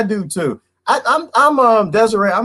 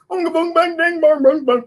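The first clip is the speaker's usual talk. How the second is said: mock drum chant. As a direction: bang out a silly drum rhythm with the voice.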